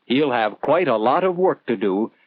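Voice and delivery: ordinary delivery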